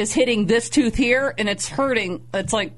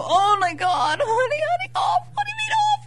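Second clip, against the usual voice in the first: very high, whiny alarmed squeal; hammed-up panic. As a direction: squeal in mock horror. Retold panic at "something off.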